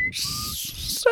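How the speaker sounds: high whistling S; whistled S. Retold or imitated. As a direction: whistle on the S like an old man. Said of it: "President Nelson has the whistle".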